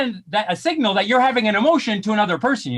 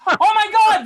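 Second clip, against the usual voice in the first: higher, sudden cry of discovery; surprised oh my God; 7 semitones higher than usual. Real, not acted. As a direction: gasp it out as if you just found them. Finds eyebrows lifting his skin.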